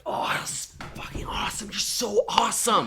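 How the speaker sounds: gushing fans. Retold or imitated. Guests gushing over her playing.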